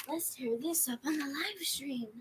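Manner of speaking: whispered playful take. Shown as a demonstration. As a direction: breathe the whole line. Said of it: "repeating in varied voices".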